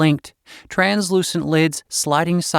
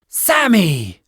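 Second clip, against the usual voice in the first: snapped; 5 semitones higher than usual. A narrator's reading. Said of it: "Trista snapped".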